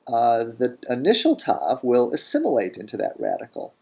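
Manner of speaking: ordinary delivery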